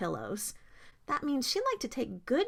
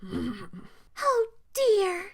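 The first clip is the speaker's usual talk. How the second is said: moaned dismay; 9 semitones higher than usual. A narrator's reading. Goldilocks complaining about Papa Bear's chair, moaned. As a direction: moan it in a high little voice. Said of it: "she moaned".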